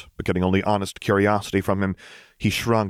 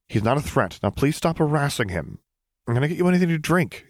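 flustered high-pitched rush; 8 semitones higher than usual. A narrator's reading. Luke shooing clones, hurried.

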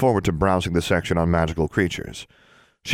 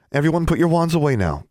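calling out; 10 semitones higher, 3 dB louder than usual. A narrator's reading. Teacher calling to children.

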